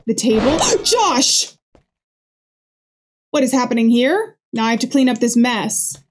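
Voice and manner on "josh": gasp, then a high cry of the name; startled high gasp and shout; shocked gasp. Real, not acted. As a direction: gasp and call out in real shock. Discovers wrecked bar.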